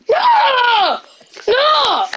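high, drawn-out "no"; dismayed cry. Real, not acted. Gamer reacting to a setback in play, dismayed cry. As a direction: cry out in dismay, pitch soaring then falling. Player wailing at game setback.

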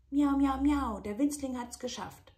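high, loud triumphant meows; triumphant kitten. Acted. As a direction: cheer like a proud kitten.

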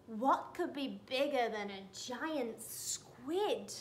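usual speaking voice

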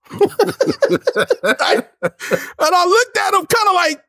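high, slow words through laughter; cracking up while telling it; real laughter breaking into speech; laughing. Real, not acted. Laughing at his own story.

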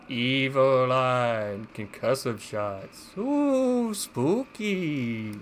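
slow, high spooky ghost voice; haunted house voice. Pretend. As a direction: draw the words out like a haunted house host. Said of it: "What am I doing haunted house voice for?".

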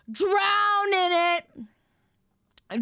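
hugely drawn-out, high held vowel; over-the-top elongated wail of the word; melodramatic. Pretend. Dramatic repeat of drowning wish.

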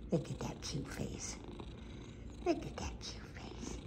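soft whispered cooing. Real, not acted. Owner admiring her dozing cat, whisper. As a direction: whisper it so he stays asleep.

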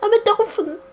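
very high squeak; squeaky dolphin voice. Pretend.

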